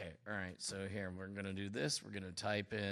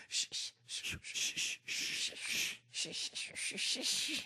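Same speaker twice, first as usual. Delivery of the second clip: shushing crowd; 12 semitones higher than usual. Retold or imitated. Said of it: "they were like".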